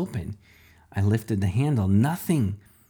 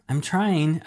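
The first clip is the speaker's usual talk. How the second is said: through clenched teeth; 6 semitones higher than usual. A narrator's reading. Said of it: "with clenched teeth".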